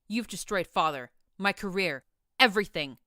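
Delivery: building to a loud furious outburst; angry hiss. A narrator's reading. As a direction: spit the accusations, rising to a yell. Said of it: "Dusk hissed up at her".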